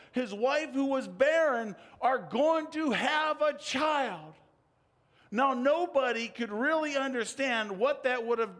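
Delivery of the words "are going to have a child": put-on slow, lifted proclamation of a child; angel's announcement. Angel Gabriel telling Zechariah he will have a child, slow and lifted. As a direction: announce it like an angel bringing news.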